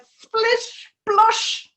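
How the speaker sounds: playful rising "splish, splosh", slow then up high; splish splosh sound effect. A narrator's reading. Narrating the frogs' fall into milk, playfully. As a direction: pop the splash words like a cartoon.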